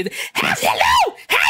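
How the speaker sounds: screeching, very high cartoon shout; Moki. Voice actor shows his character, screechy loud falsetto. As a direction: squawk the greeting in a harsh falsetto. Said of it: "And then you have Monkey".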